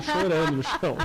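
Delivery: amused, breaking into a laugh; tickled by the story. Real, not acted. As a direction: say it through laughter. Funny story, laugh heard.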